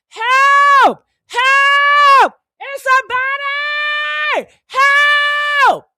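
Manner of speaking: high, loud shrieking help; screaming for help. Pretend. Finding the body and shrieking for anyone to come. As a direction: yell it to the whole building, way up high. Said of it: "I'd be screaming".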